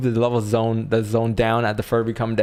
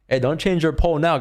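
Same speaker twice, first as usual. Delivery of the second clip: raised, higher playful protest; playful call-out; 6 semitones higher, 5 dB louder than usual. Real, not acted. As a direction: call out to the chat teasingly. Chat switching poll answers live.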